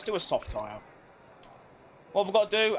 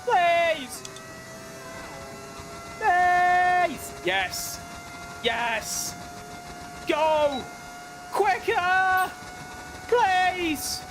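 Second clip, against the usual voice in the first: high-pitched, drawn-out pleading cries; strained high yelps, willing it on; urgent pleading; 10 semitones higher, much slower than usual. Real, not acted. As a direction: beg the car to go quicker. Urging car to gain back time.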